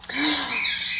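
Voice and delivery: sharp surprised gasp; a delighted little gasp. Acted. Storyteller gasps at the mighty Thor cover.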